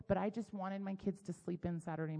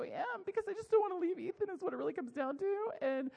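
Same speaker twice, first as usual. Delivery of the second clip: high, tearful mock wail; imitated crying; 11 semitones higher, faster than usual. Wife explaining to husband why she's upset, mock crying. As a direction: act out blubbering through an explanation. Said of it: "I started crying".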